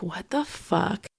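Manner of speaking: slow, hushed disbelief; soft retold reaction, drawn out; stunned undertone. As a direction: say it under your breath, slowly, as if appalled.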